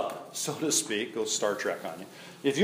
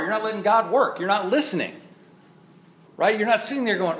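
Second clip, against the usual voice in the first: normal talking voice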